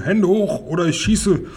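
high narrated holler; mild bellowed threat. A narrator's reading. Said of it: "und brüllte".

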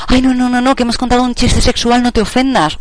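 mock-fussy "oh no, no", voice lifted high; well-meaning reassurer. People excusing a sexual joke in good faith, mock well-meaning. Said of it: "lo típico esto de que con toda la buena fe".

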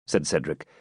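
usual speaking voice

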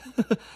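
high, loud little laugh; delighted laugh. A narrator's reading. Guest recalling his lottery win, laughing delightedly. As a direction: laugh brightly in amazement.

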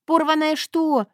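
slow, high shocked question; startled. Acted. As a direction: gasp it out. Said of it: "икнула я".

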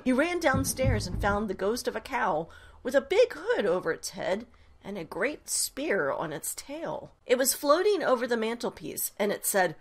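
plain reading voice

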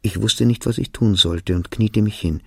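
usual speaking voice

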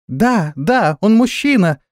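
high-pitched happy chiming in; joyful agreement. A narrator's reading. Flying girls back their priestess, joyful. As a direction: chime in gleefully, pitch climbing. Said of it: "радостно загомонили летуни".

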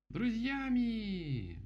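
high, long drawn-out cheer; loud shouting. A narrator's reading. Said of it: "громко закричали они все вместе". (громкий протяжный крик)

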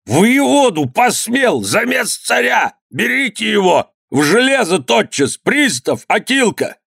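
very high-pitched outraged cries; shrieking. A narrator's reading. Struck governor calling his men, shrieking. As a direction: squeal orders, beside yourself. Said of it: "крича".

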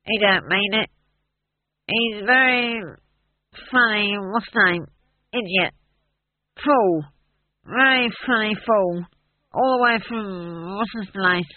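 put-on slurred, halting drunken rambling; drunk dwarf. A narrator's reading. Drunk dwarf excusing his friend to the bar, slurred and halting. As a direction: grope boozily for each word, dragging it out. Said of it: "horrified to see the rest of him being drunk".

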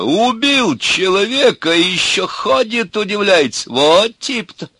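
high, slow, loud indignant exclamation; exclaiming. A narrator's reading. Urka accuses the bespectacled man, exclaiming. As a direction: draw it out in loud amazement. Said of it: "воскликнул урка".

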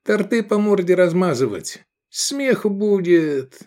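high, mocking character voice; jokey sidekick; Edik's voice. A narrator's reading. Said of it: "проговорил Эдик".

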